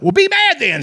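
high sneering voice; mocking retort. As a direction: taunt him with a lifted sneer. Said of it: "he's like".

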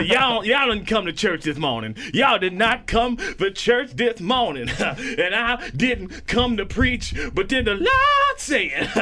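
high, drawn-out sermon cadence with gasping laugh-like breaths; fervent preacher. Acted. Pastor preaching scripture to his congregation, fervent. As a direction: stretch each phrase like a pulpit preacher.

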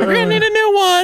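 very high falsetto mom voice; Cameron's mom. Retold or imitated. Host mocking a co-host's visiting mother, falsetto mom. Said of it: "his mom was like".

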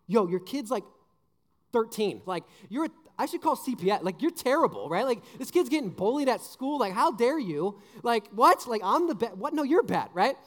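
a hammed-up rant at a clueless parent; mock outraged rant.

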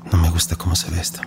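unvoiced worried whisper. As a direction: breathe it to yourself, worried. Worried aside under breath.

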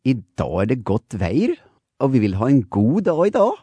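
Norwegian lilt, rising toward the end; cheerful Norwegian singsong; Norwegian sing-song. Shown as a demonstration. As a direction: sing the Norwegian, melody going up. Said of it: "Norwegian, it will be like this".

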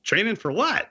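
high-pitched rising question; mock incredulous. Group questions guide's training, high mock incredulity. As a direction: question it in high, amused disbelief.

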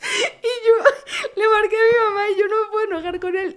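high, laugh-shaken words. Real, not acted. Wife retells calling her mom, laughing as she speaks. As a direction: giggle through the whole line. Recalling first fight, laughing.

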